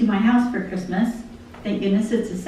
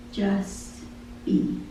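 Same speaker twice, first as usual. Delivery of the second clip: softly breathed out; hushed; 9 dB quieter than usual. Acted. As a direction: hush it like a gentle calling. Soft voice of God in lyric.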